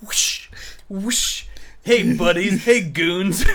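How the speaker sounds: high, hammed-up bully voice; overacted anime dub. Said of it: "the dub voice of".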